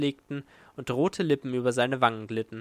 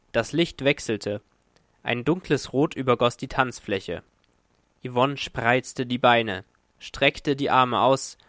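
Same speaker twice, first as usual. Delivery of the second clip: ordinary delivery, nothing marked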